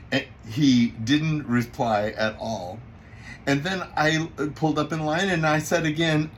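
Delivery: plain reading voice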